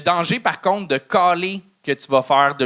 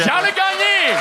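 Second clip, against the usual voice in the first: announcer shout. Real, not acted. Host calling out comic's name.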